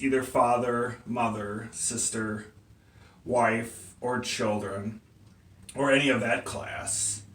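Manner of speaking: low, soft, one word at a time; subdued, grave enumeration; hushed listing. Acted. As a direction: let the voice sink as you name each one. Defendant lists loved ones solemnly.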